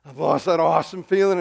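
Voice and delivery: sing-song it through a laugh at himself. Real, not acted.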